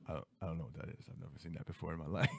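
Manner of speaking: put-on low, soft, guilty kid mumble; meek denial. Caught child denying the candy to mom, meekly. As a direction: shrink down and deny it quietly.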